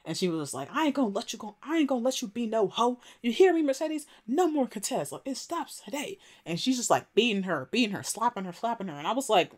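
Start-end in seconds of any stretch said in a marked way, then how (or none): 0.7-6.2 high-pitched mock-furious mother; acted-out high, insistent telling-off; imitated scolding. Voicing mother scolding while slapping daughter.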